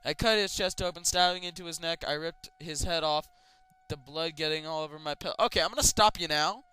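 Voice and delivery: ordinary delivery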